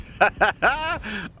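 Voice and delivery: very high, helpless laughing; high-pitched laughing fit; hysterical laugh. Real, not acted. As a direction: crack up completely. Caster laughing uncontrollably.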